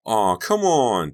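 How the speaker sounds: high, loud, drawn-out whine; whining little boy. A narrator's reading. Donnie begs for the mask, drawn-out child whine. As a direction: plead slowly like a whiny kid. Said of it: "Donnie whined".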